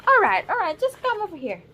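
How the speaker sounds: coaxing call back to the lap. Real, not acted. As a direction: call the wriggling little one back, bright and high. Settling the restless baby.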